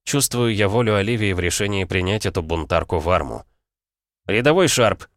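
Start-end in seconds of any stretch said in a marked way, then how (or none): 4.3-5.1 barked call of a name; shout. Acted. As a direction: call her sharply across the ranks. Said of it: "крикнул я ей".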